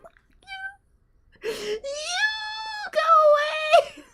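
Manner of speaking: high crying shout; frightened scream. Real, not acted. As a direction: scream it away, half laughing. Screaming at game monster.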